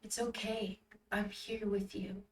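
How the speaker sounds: soft, gentle comfort; reassuring. Acted. Yale reassuring Rinku as she cries, softly. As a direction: soothe quietly. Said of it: "giving me a reassuring rubs".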